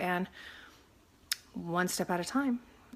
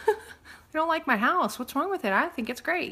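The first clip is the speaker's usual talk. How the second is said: mock-hurt higher-pitched complaint; hurt, rejected; 9 semitones higher than usual. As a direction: play the mildly wounded home seller. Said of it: "I'm feeling rejected".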